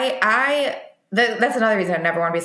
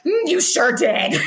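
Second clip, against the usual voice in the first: awkward reply; 8 semitones higher, slower than usual. Retold or imitated. Awkward reply in front of coach.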